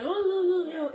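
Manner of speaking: high, loud imitated objection; mock protest. Youth imitating the man objecting to mom, mock protest. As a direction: imitate someone loudly brushing off a telling-off.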